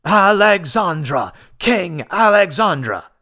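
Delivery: high, loud slow chant; tumultuous cheer. A narrator's reading. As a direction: crowd roaring their new king's name. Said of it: "A tumult interrupted him".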